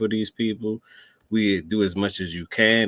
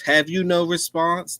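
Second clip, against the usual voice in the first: higher, hammed-up English reporter; lightly mocking British reporter question; mock British accent; 8 semitones higher than usual. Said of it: "That you from England or something".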